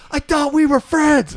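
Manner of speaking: high, slow, wounded whine; hammed-up hurt buddy; hurt friend whine. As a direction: sound betrayed by a pal. Kyle voiced hurt.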